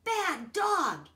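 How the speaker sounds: loud, high half-scold; boy nearly scolding the dog; almost scolding. Acted. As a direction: start to scold, then hold back. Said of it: "he almost scolded".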